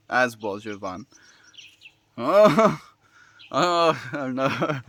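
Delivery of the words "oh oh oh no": laughing. Real, not acted. Embarrassed by her own mistake.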